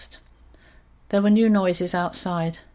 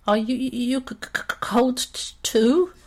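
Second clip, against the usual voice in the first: higher, teeth chattering, stammering on each c; shivering stutter; 5 semitones higher than usual. A narrator's reading. Goldie asks Horatio if he's cold, chattering and stuttering. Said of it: "Goldie's teeth started to chatter with cold".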